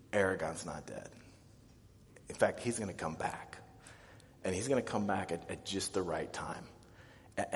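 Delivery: soft, low reassurance; hushed, gentle storytelling to a child; soothing father. Acted. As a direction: comfort an upset child, voice dropped. Father soothing distraught child on lap.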